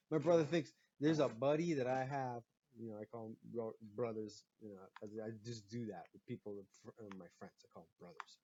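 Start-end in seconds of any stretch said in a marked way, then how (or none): none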